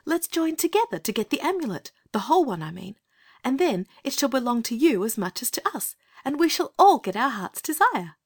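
put-on very high-pitched, coaxing little girl; kind little voice. Girl offering the captive priest a treaty, kind little voice. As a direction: offer friendship kindly, in a small high voice. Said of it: "in her kind little voice".

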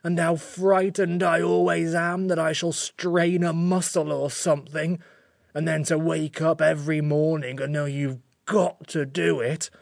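slow, plaintive creature lament; sorry-for-itself creature, close to tears; tearful. A narrator's reading. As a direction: pour out the misery, stretching each word. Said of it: "it said in a tearful voice".

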